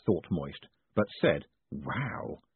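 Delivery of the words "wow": low, soft feigned wonder; well-crafted surprise. A narrator's reading. Customer feigning amazement at a pin, soft and low. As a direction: fake being impressed, quietly. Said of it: "in a tone of well-crafted, genuine surprise".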